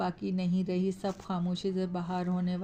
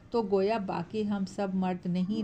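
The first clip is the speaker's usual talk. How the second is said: usual speaking voice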